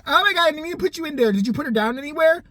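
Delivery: fast, high, flustered questioning; alarmed urgency. Retold or imitated. Clinic girl realizes mistake about the room, fast alarm. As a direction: blurt it out in sudden alarm.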